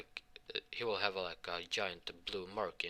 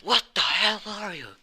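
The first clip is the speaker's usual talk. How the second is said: much higher and much louder, a sudden yelp of surprise; shocked shout; 13 semitones higher, 11 dB louder than usual. Acted. The kids see her armor change, startled loud yelp. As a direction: blurt it out high and loud in shock.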